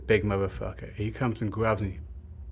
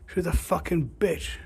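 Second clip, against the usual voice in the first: mock rant; 8 semitones higher than usual. Ranting to mate in street.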